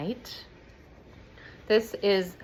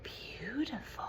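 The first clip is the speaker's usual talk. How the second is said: softer, breathless whisper of wonder; awestruck whisper; 7 semitones higher, 9 dB quieter, much slower than usual. Retold or imitated. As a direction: whisper it, struck by her beauty. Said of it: "and he goes".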